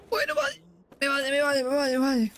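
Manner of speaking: high, giddy "me vale" repeated; relieved squeal. Real, not acted. Player survives the jump, giddy high relief. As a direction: chirp it out in relief.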